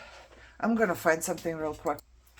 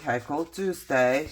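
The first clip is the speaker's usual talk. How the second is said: ordinary delivery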